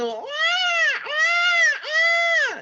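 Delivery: very high, loud mock baby crying; imitated baby wail. Preacher imitates a hungry baby crying, high wailing. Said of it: "Terus menangis bayi itu".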